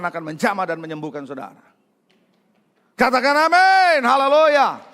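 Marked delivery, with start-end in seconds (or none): 3.0-4.8 high, loud, slow rallying cry; preaching at full force. Acted. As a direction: lift the whole church. Preacher rousing congregation. (seruan khotbah penuh semangat)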